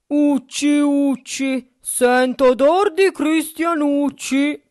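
very loud, high, slow ogre chant; ogre's yell. A narrator's reading. Ogre sniffs the air for humans, yelling. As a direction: bellow it, stretching the rhyme. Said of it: "e urlò".

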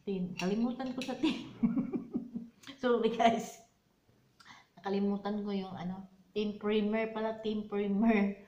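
talking around a spoon; eating while speaking; mouth full. Real, not acted. Seen 2: Spoon with purple ice cream at lips throughout the speech.

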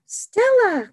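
high, loud excited call; cried out. Acted. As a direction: call out her name with excitement. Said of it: "cried Sam".

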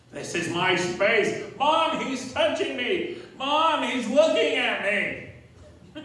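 high, loud, slow tattletale whine; whiny tattling little sibling. Acted. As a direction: tattle to Mom like a bratty kid. Acted kids tattling to Mom.

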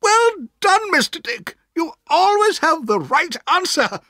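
very high, bright praise; delighted. A narrator's reading. Aunt praises Mister Dick, delighted. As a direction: beam at him as you praise. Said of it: "said my aunt delightedly".

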